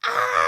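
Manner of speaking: loud retold chainsaw-man scream; mock attacker's scream. Storyteller acting the chainsaw man charging at them, mock scream. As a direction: imitate a haunted-house attacker's yell. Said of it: "running at us, just going".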